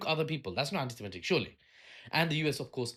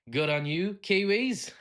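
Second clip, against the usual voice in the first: mock New Zealand accent, pitched up; 7 semitones higher, 4 dB louder, slower than usual. Teasing New Zealand viewers, mock New Zealand accent. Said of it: "that was a New Zealand accent".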